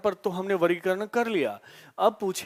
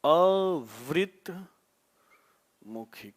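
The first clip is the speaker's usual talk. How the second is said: slow teaching emphasis; much slower than usual. Shown as a demonstration. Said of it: "इसको हम बोलते हैं".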